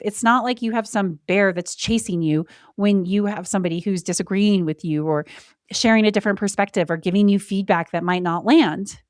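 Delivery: usual speaking voice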